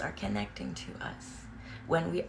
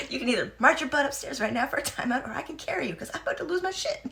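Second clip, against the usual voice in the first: louder, higher mock-stern ultimatum; stern mom ultimatum; 8 semitones higher, 4 dB louder, faster than usual. Mom giving the kids an ultimatum, higher, half laughing. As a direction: lay down the law, half laughing. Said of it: "I give fair warning".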